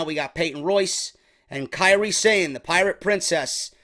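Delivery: usual speaking voice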